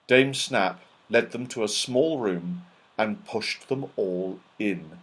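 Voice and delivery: usual speaking voice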